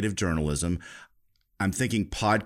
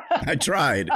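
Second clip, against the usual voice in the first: laughing; 7 semitones higher than usual. Real, not acted. Laughs after a gloomy answer.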